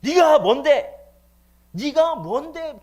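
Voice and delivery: very high, full-force angry yell; acted furious shouting rebuke; loud scolding. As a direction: roar the challenge, stretching the repeat. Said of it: "막 큰 소리 하면서".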